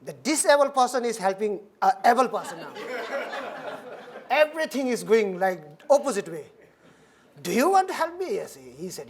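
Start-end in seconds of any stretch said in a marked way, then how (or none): 2.8-4.2 soft, breathy chuckle; amused quiet laugh. Real, not acted. Laughing at role reversal. 7.4-8.3 surprised. Retold or imitated. Disbelief at offer of help.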